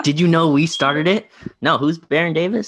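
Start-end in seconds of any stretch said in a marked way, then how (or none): 0.0-1.3 mocking Warrior fan. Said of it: "They're like".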